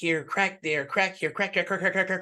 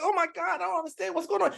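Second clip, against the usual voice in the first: much higher, strained panicky voice; panicked confusion; 14 semitones higher, much faster than usual. Acted. Politician finds hell in flames, high panicked cry. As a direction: panic as it all falls apart.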